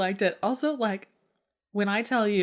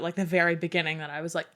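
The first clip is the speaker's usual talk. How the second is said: ordinary talk, nothing marked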